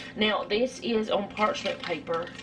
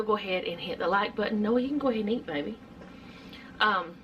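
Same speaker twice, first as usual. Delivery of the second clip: normal talking voice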